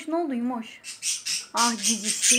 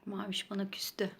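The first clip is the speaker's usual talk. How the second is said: soft whisper about the sulking bird; hushed remark about a pet; whispered aside; 4 semitones lower, 14 dB quieter than usual. Real, not acted. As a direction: murmur it under your breath, barely voiced.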